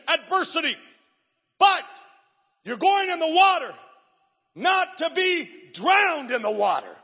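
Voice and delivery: high, loud, hammering preacher's shout; preaching at full force. Acted. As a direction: drive every line home to the crowd. Preaching climax.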